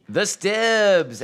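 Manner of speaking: hype announcer call. Real, not acted. Hype introduction of guest.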